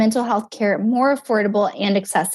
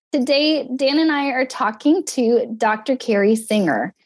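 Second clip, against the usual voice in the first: usual speaking voice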